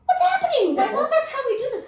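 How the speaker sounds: very high, loud, laughing wail; hammed-up baffled outcry; bewildered protest. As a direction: squeal it in comic confusion. Retelling own bewildered protest.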